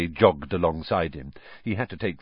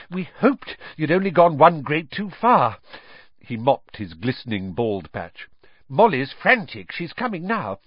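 out of breath; 6 semitones higher, 4 dB louder than usual. Acted. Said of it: "Mister Weasley panted".

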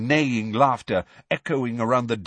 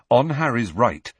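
usual speaking voice

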